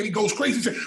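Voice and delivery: normal talking voice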